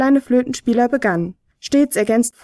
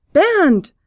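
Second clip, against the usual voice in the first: hoch und lauter gerufen; überrascht den Namen rufen; 8 semitones higher, 4 dB louder than usual. A narrator's reading. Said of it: "rief der Flötenspieler". (überrascht gerufen)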